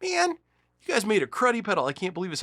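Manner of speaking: high, loud, indignant complaint; hammed-up outrage at a letdown; mock angry. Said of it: "Now I'm mad at the pedal company".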